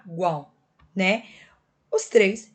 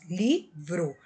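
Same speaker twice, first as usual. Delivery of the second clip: livro in two lower, separated syllables, li, vro; 4 semitones lower than usual. Shown as a demonstration. Teacher shows both consonant sounds, livro syllable by syllable. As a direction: break livro after li, keeping v and r together. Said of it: "Eu uso o som das duas".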